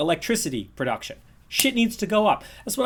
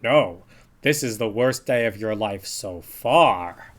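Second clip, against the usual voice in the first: imitating Homer; 8 semitones lower, slower than usual. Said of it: "Homer corrected him".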